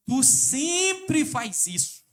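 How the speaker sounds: slow, loud, high scornful jab; mock contemptuous accusation. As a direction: drag it out with contempt. Acting out contemptuous couple speech.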